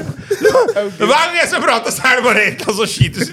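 high, loud laughing complaint. Real, not acted. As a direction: complain while cracking up. Laughing complaint about interruptions. (høy, leende klage)

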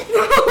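high, loud burst of laughter. Real, not acted. Player laughs at colorido as a color, amused.

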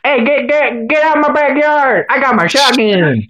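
high-pitched mock Southern holler. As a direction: holler like a backwoods Alabama homeowner. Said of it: "we don't talk like this".

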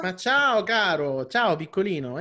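più alto e forte, affettuoso; parlando a un bambino. Real, not acted. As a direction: saluta il piccolo con entusiasmo. Saluta il bambino dell'ospite. (ospite che saluta il bambino, tono affettuoso)

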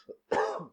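short hacking dry cough. Real, not acted. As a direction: clear the throat with a dry cough. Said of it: "dry cough".

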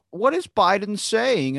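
high, slow puzzled anchor question; mock news anchor. Australian news mocks the American president, in a high, slow, baffled voice. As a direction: ham up a baffled presenter. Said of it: "and like".

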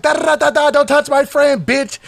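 high-pitched retold tirade; heated rant. Ranting at rivals across the patio, heated retelling. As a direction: rattle off a mock-heated warning up high.

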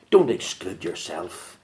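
ordinary talk

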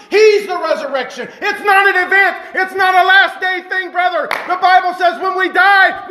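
high-pitched, full-force sermon delivery; impassioned preaching. Real, not acted. Preacher tells church He is the resurrection, fervent proclamation.

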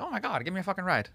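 high-pitched mock excitement; pretend naive eagerness. Imagined kid accepting a stranger's ride, mock eager. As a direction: play a gullible kid, lightly.

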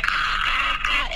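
high, hammed-up screams; mock scream. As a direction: fake scream of a man being dragged. Husband screaming as pulled under.

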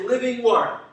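rousing call-and-response shout; enthusiastic. Shown as a demonstration. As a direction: say it with energy to the room. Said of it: "say it a little more enthusiastically".

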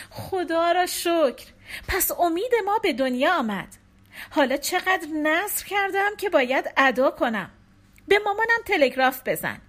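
a new mother bursting with relief; hint of a giddy young mother; joyful young wife. A narrator's reading. Wife voiced, joyful after birth.

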